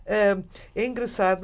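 ordinary talk

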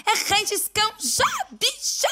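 camp, high-pitched command; sassy high squeal. Acted. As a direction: squeal it out sassily. Sassy urging punchline.

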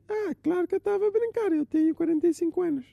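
high, indignant show-off uncle; a boastful uncle talks down to his nephew in a high voice; arrogant uncle. Acted. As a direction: puff up and snap back like a know-it-all uncle.

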